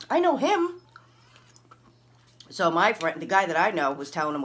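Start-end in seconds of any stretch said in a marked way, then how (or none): 0.1-0.8 very high, loud stressed protest; acting out heated exasperation; exasperated insistence. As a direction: insist with your voice way up. Acted outraged explanation.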